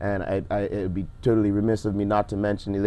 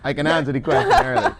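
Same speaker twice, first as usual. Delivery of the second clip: laughing; 8 semitones higher, 4 dB louder than usual. Real, not acted. Guest laughing at host's teasing.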